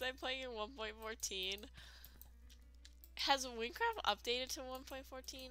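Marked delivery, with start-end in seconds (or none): none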